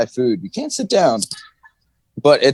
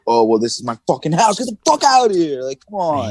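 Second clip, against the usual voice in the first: mock angry retort; 5 semitones higher than usual. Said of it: "And I'm like".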